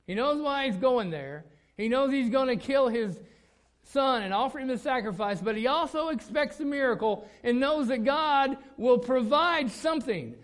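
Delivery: loud, high, fervent sermon delivery; preaching at full force. Acted. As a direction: preach it to the back row. Preacher builds to climax.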